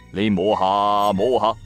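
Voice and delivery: ordinary talk, nothing marked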